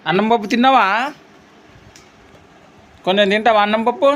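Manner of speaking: father playing with his little son. Real, not acted. Father talks to his small son.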